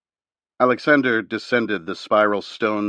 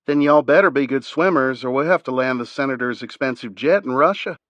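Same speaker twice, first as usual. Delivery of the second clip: a southern drawl laid on thick; 5 semitones higher, 4 dB louder than usual. Acted. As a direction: joke it in a heavy Southern accent. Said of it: "in her thickest southern drawl".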